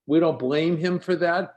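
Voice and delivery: ordinary talk, nothing marked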